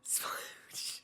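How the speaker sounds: whispered. Acted. Whispering the silly word.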